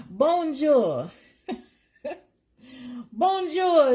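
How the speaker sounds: high, loud playful French hello; excited bonjour. Acted. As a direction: sing out a gleeful bonjour. Excited French greeting to viewer.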